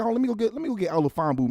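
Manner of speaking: ordinary talk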